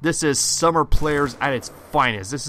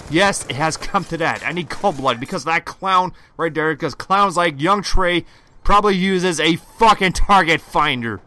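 raging gamer; 4 semitones higher, 8 dB louder than usual. Real, not acted. Gamer ranting about an enemy.

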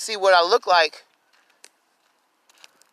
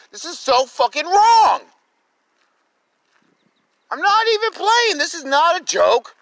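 furious; 7 semitones higher than usual. Real, not acted. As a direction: make them believe you're not joking. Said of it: "I am fucking mad".

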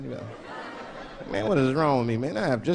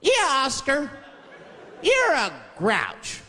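put-on high kids' voices, slow taunt; chorus of kids; 8 semitones higher, much slower than usual. Kids on the show teasing Oscar, high taunt. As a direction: chant it like a TV kid. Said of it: "They judge him right in his face".

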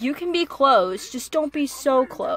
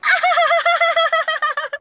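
high squealing laugh; 14 semitones higher, 5 dB louder than usual. Real, not acted. Fangirling over skaters.

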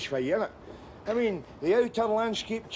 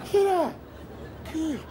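calling out name; 10 semitones higher than usual. Acted.

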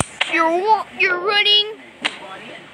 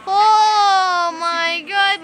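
slow, stretched crying exclamation; mock relieved cry; 2 semitones higher, 5 dB louder, much slower than usual. Game hero saved from the monster, slow relieved cry. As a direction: drag it out like a half-sobbed gasp of relief.

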